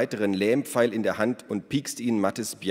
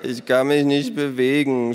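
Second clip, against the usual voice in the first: loud, higher-pitched strained groan; 5 semitones higher, 7 dB louder than usual. A narrator's reading. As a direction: groan it out, helpless and paralysed. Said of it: "stöhnte Ada". (laut gestöhnt, hilflos)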